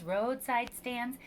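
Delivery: usual speaking voice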